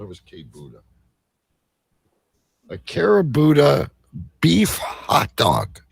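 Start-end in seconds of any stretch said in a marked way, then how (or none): none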